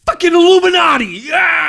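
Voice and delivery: high, loud gleeful yell; excited shout. Retold or imitated. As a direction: yell it like a wild revelation. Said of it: "Point C".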